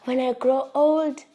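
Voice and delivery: put-on hint at an earnest boy announcing plans; lightly voiced little boy. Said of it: "He's like".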